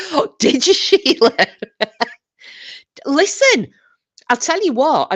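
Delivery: loud, high gossipy excitement; excited. Real, not acted. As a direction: lean in, thrilled by the gossip. Excited reaction to chat gossip.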